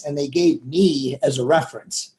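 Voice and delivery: ordinary delivery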